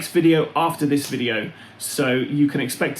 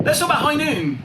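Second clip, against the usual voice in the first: mock announcer; 13 semitones higher than usual. Announcing next topic, showy.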